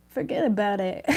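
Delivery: put-on a lightly done New York mob boss accent, forget about it slurred; a hint of a Mafia boss accent, 'Forget about it' run together. Tarot reader tries a mob boss line, light New York mob accent. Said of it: "I can't say it with that accent".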